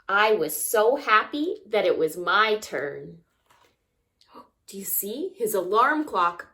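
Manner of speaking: ordinary delivery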